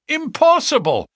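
high, loud shocked cry; shocked outcry. A narrator's reading. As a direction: blurt it out in disbelief. Inspector's outraged exclamation.

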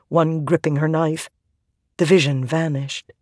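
ordinary delivery

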